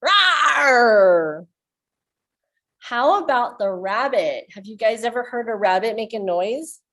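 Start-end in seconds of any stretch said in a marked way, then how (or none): 0.0-1.5 louder playful rawr; tiger roar. Shown as a demonstration. Teacher roaring like a tiger for children. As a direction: growl it like a cartoon tiger. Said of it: "So you can go".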